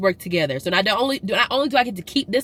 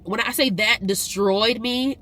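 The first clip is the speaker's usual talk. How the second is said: ordinary talk, nothing marked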